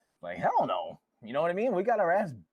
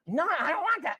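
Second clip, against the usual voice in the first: put-on whiny kid; 13 semitones higher, 3 dB louder than usual. Imitating spoiled kid.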